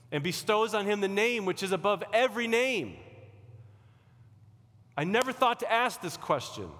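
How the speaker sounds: rising sermon crescendo; preaching. Acted. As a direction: build it to a triumphant climax. Preaching God's exaltation.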